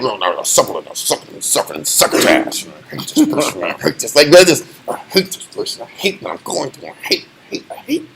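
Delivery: low, hushed hateful mutter; inner grumble. Retold or imitated. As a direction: grumble your hidden hate under your breath. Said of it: "You're like".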